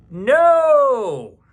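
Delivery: put-on high, loud child shout; a little kid yelling no; child's refusal.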